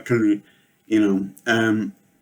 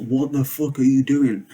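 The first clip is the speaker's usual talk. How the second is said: retold outraged cry; 5 semitones higher, 3 dB louder than usual. Egg smashed against a wall.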